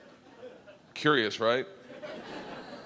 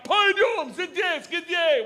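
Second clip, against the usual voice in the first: higher, louder barked French order; 14 semitones higher, 5 dB louder, slower than usual. Retold or imitated. The cop barking at the narrator in French, loud and high. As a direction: bark it like an angry French cop. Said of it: "I hear him bark out".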